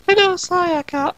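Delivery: a dainty high-society greeting; high dainty girl greeting her friend; prim Hitomi voice. Acted. Hitomi character voice.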